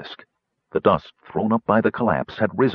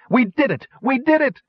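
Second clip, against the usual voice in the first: triumphant shout. A narrator's reading. Kitiara celebrating the tower's fall.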